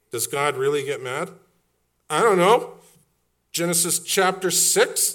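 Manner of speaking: loud, high, rising preacher's refrain; preaching at full force. Acted. Pastor rousing his congregation, preaching hard. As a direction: hammer the question home to the congregation.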